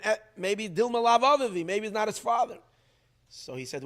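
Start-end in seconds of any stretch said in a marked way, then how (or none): 0.0-2.6 high, loud challenging retort; louder higher rebuke from the tzaddik; raised rebuke. Retold or imitated. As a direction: throw the question back at him sharply. Son repeating the rebuke to father.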